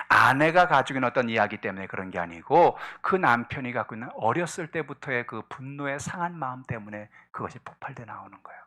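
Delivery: ordinary talk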